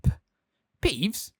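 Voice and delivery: confused stammer. A narrator's reading. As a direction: stammer the name out. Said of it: "stammered Filch".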